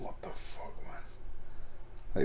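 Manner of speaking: breathless whisper to himself; stunned whispered what the fuck; whispered disbelief. Retold or imitated. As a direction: whisper it at the screen. Staring at the TV, drunk.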